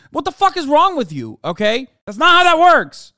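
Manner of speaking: loud, high exasperated yelling; angry rant. Real, not acted. Streamer yelling at a commentator over a joke, angry and loud. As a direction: shout it, fed up.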